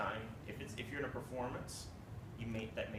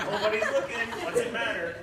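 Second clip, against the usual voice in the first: laughing mock thought; 11 semitones higher, 11 dB louder than usual. Conductor voicing his own careless thought.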